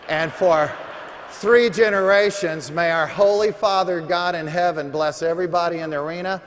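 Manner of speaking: high, loud revival blessing to the arena; preaching blessing. Acted. Seminar speaker blessing the arena crowd before break, preaching full force.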